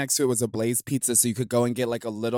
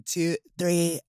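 a hint of Britney's breathy three; [name] impression; 4 semitones higher than usual. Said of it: "It is [name]'s one".

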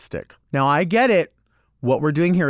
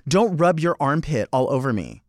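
normal talking voice

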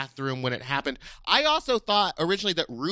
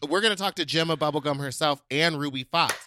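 normal talking voice

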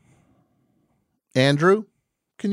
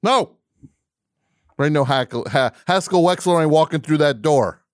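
mock pep speech; 7 semitones higher, 6 dB louder than usual. Mock coach-speech boast.

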